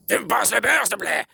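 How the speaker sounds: high, put-on bossy demand; mock order. Shown as a demonstration. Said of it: "je te donne un ordre".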